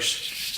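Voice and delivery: soft, unvoiced "shh"; imitated shushing. Brother hiding him from mom, urgent hushing. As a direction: hush a crying kid under cover.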